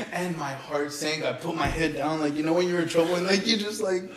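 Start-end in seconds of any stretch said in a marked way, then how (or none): none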